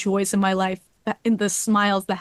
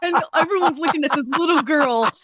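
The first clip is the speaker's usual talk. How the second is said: higher, laughing through the words; 6 semitones higher than usual. Real, not acted. Fan telling a funny childhood story, laughing as she talks. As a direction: tell it while cracking up.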